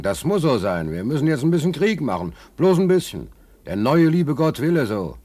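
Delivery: high, sneering put-on official voice; high mocking voice of those in power; mock warmonger. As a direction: parody their soothing excuse for war. Mimicking warmongers' excuse.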